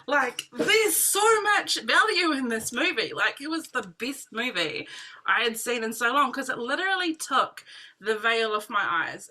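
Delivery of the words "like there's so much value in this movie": higher, slow, eager pleading to her husband; excited urging. Retold or imitated. Waking her husband up at night, excited and insistent. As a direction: wake him up and sell him the movie.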